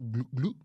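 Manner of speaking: imitated gulping gut rumble; comic tummy noise; stomach gurgle. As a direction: make short bubbling gurgles.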